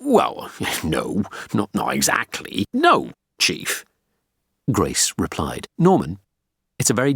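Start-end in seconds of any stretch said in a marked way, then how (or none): 0.0-3.8 hesitant, backtracking denial; embarrassed subordinate fumbling for an answer; awkward, flustered. A narrator's reading. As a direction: stumble awkwardly over the denial, then insist. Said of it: "Potting mumbled awkwardly".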